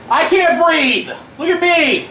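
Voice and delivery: high, slow, whiny mimicry; mock him by echoing his whine; mocking imitation. Mocking dad's complaint.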